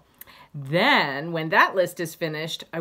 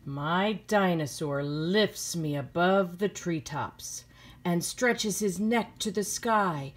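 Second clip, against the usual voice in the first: slow, gentle storybook reading; reading to children; 4 dB quieter than usual. A narrator's reading. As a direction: read it like a bedtime story to kids. Teacher reads picture book aloud.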